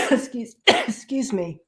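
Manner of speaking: apologizing between coughs; coughing. Real, not acted. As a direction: choke out an excuse. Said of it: "it went down the wrong way".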